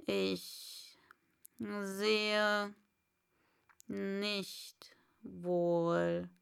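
breathy, half-whispered caterpillar; a slow, airy caterpillar; Raupe. Acted. Raupe voiced by narrator. (gehauchte Raupenstimme)

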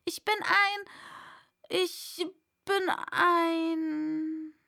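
high start, then a long drawn-out last word; hesitant, stretched-out attempt; faltering. A narrator's reading. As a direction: search for the word and stall. Alice falters saying what she is.

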